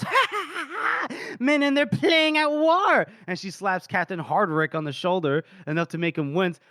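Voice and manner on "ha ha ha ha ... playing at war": very high, slow mock laugh into jeer; theatrical mocking lady, building louder; hammed-up derision. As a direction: draw it out high and scornful. Voices lady mocking the officers.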